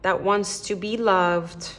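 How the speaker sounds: slow, hushed prayer to the spirit guides; tarot reader praying to her spirit guides, slow and hushed; prayerful invocation. Acted. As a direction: call gently on the angels, unhurried.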